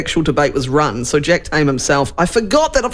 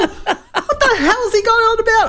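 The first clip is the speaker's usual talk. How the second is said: mock exasperated yelling; 8 semitones higher than usual. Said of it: "yelling and laughing at the".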